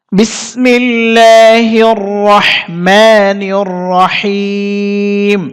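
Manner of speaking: slow, melodic Quranic recitation. Shown as a demonstration. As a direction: chant it slowly in a tajwid style. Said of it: "একবার reading করে দিচ্ছি". (ধীরে সুরেলা তিলাওয়াত)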